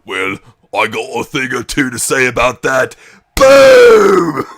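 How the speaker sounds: hammed-up challenge ending in a huge shout; mock Wade Barrett. Barrett butting in on Punk, hammed-up shout. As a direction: bark the threat, then explode on boom. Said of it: "Wade Barrett and".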